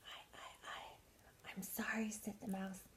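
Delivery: faint, halting whispered apology; hesitant whisper. A narrator's reading. Mouse apologises for its cold, faltering whisper. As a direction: whisper it in small stuttering starts.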